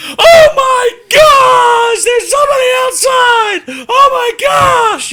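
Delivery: hammed-up freak-out, very high loud shriek; Mozart. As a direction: shriek like a panicking little yapper dog. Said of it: "Freaking out".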